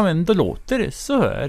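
slow, put-on Sweden-Swedish accent; a Finland-Swede showing how his Sweden-Swedish accent sounds; Sweden-Swedish imitation. Shown as a demonstration. Finland-Swede demonstrating his attempt at Sweden-Swedish accent. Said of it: "jag kan försöka härma svenska".

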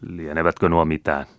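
usual speaking voice

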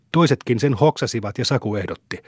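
ordinary delivery, nothing marked

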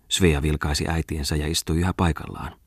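usual speaking voice

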